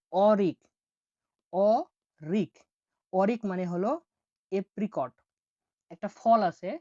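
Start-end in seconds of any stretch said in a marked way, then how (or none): 0.1-0.6 Arik said loud and clear, falling; clear model word. Shown as a demonstration. Teacher models Arik loudly for the class. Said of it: "দেখুন". 1.5-2.5 Arik drawn out very slowly, every sound long; stretched out. Shown as a demonstration. Teacher drags out Arik so learners hear each sound. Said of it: "দেখুন".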